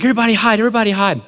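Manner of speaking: high, urgent warning; mock panic. Prankster telling everybody to hide, high mock panic. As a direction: urge them all in pretend fright.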